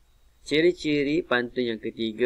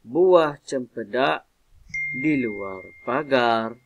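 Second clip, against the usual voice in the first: slow, loud "Buah cempedak", pause, then "di luar pagar"; mid-line pause; 5 dB louder, slower than usual. Shown as a demonstration. Teacher demonstrating jeda to pupils, pause between "cempedak" and "di". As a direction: draw out "Buah cempedak", break clearly before "di luar pagar". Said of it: "Dia akan berhenti".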